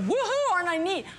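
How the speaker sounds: high, pretend cheer; hammed-up bragging; mock self-congratulation. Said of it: "I'm not like going".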